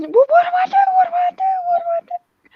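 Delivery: high, loud, frantic wail close to crying; panicked shriek. Retold or imitated. Carol panics, repeating herself.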